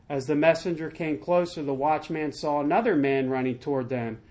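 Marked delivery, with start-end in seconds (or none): none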